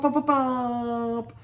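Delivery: high, loud popping sounds; cartoonish popping noises; dragon popping noise. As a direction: pop it out like a little dragon.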